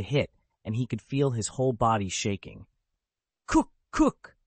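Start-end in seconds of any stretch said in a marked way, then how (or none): 3.5-4.4 harsh repeated coughs; violent coughing. A narrator's reading. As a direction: hack out two coughs. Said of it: "Reyes continued to cough".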